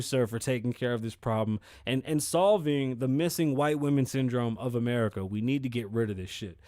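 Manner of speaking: usual speaking voice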